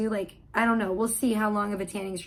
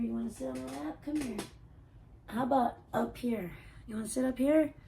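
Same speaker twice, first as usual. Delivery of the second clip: soft pet voice calling her cat; 2 semitones higher, 5 dB quieter than usual. Real, not acted. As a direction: invite the cat up sweetly. Coaxing cat onto lap.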